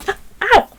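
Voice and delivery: sudden sharp ow of pain; pained yelp. Real, not acted. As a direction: yelp as the hot glue burns. Burns finger on glue gun.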